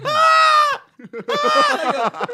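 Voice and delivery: very high, loud shrieks; piercing comic screaming; repeated mock screams. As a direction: scream over and over in mock terror. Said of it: "I'm like, ah!".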